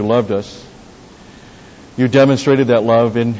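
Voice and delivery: a steady, earnest closing prayer to God; pastor's benediction prayer before the break. Acted. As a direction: pray it sincerely for the congregation.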